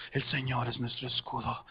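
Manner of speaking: very low, hushed army murmur; murmullo del ejército. Shown as a demonstration. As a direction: murmur it low and soft, like soldiers before battle. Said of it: "como que el murmullo del ejército". (murmullo grave y muy bajo de ejército)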